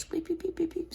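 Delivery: high whispered squeaks; breathy high beeps like a squeeze toy; imitating a squeaky toy; squeaky toy noises. Mimicking dog's squeaky toy.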